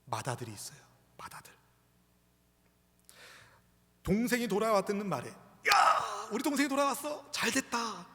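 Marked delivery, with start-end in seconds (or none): 4.0-5.3 higher, lifted lead-in to good news; raised, eager storytelling tone; glad anticipation. Acted. Glad reaction the brother should have had. 5.3-8.1 high, excited cry of welcome; acted delight, starting in a loud whoop; overjoyed brother. Acts a brother glad at return.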